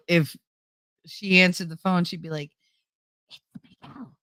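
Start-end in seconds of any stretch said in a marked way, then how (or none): 3.3-4.1 whispered aside. Retold or imitated. Grandmother whispering on the phone.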